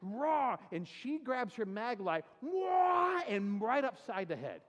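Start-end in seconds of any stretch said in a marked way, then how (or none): 0.0-0.6 mock monster roar. Scaring in a monkey mask. 2.4-3.2 sharp, high "wah" like a blow landing; high whack shout. Retold or imitated. Girlfriend cracks him on the head, a loud high whack cry. Girlfriend swings mag light.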